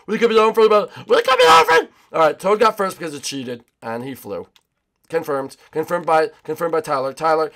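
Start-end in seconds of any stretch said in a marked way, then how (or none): 0.1-1.9 loud, high, climbing to a yell; excited shouting. Real, not acted. Gamer sprinting for the finish, rising excited shout. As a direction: build to a high yell of hope.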